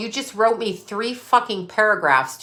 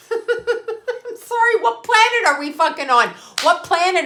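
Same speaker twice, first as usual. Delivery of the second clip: high laughing I'm sorry; laughing disbelief; 9 semitones higher than usual. Real, not acted. Reacting to a rumour, laughing high.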